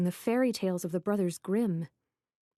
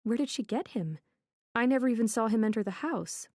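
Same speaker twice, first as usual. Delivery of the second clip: ordinary delivery, nothing marked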